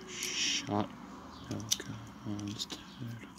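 hushed, weary aside under the breath; real worn-out grumble, trailing into a whisper; tired, irritated muttering. Real, not acted. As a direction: mutter it away from the listeners, fed up. Muttering at noisy household, worn out.

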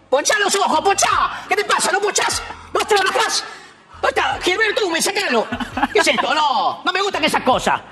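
high, Argentine ranting politician voice; Milei voice. Acted. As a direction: rant at the eye doctor in Argentine voseo. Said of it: "para imitar de Javier Milei".

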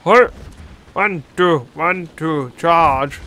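lightly voiced drill officer counting out steps. Player mimicking soldiers standing to attention, lightly mocked drill officer. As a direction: call the march like a mock army officer. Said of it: "They, like, stand to attention. It's like".

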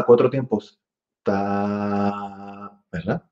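normal talking voice

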